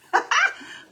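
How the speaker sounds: a high, bright burst of laughter; high excited laugh. Real, not acted. Host laughing with glee as the painted face turns cute. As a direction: let a delighted giggle bubble up, pitched high. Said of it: "I'm excited".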